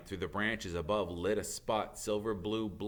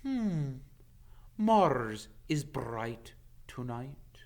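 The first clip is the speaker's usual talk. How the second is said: a hint of a grave old centaur; 4 semitones higher, much slower than usual. Ronan greets Hagrid, slow and solemn.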